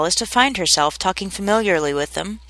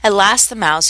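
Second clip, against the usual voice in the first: ordinary delivery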